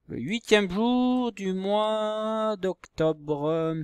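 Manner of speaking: very slow, high, stretched-out syllables; high lingering teacher dictation. Shown as a demonstration. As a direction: draw out each word as you write it. Dictating the date while writing.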